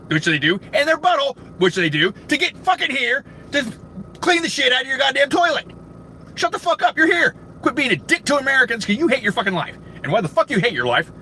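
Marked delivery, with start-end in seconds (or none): 2.3-11.1 high, strained angry telling-off; angry tirade. Real, not acted. Customer berates absent cashier, angry tirade. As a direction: scold him hotly with the pitch pushed up.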